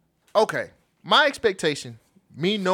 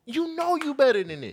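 incredulous scolding; 9 semitones higher than usual. Retold or imitated. Repeated scolding of Harris.

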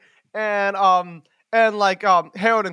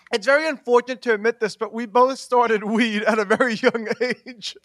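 ordinary talk

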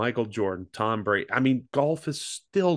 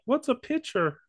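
clueless quote. Retold or imitated. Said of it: "because they're like".

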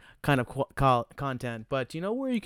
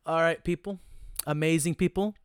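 hype announcer; 4 semitones higher, 4 dB louder than usual. Acted. Announcer-style outro.

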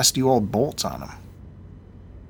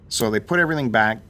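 ordinary talk, nothing marked

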